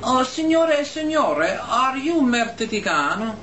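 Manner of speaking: high-pitched, drawn-out waiter's question; Italian waiter. Retold or imitated. Waiter slyly insulting an American couple at their table, mock-courteous. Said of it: "And the waiter comes over, and he says".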